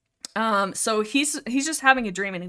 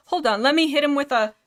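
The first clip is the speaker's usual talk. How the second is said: normal talking voice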